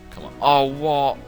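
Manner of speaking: ordinary delivery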